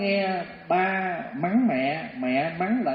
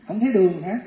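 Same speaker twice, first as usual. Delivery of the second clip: mock scold, a bit louder; scolding question; 2 semitones higher, 6 dB louder than usual. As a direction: snap the question at him. Wife scolding husband.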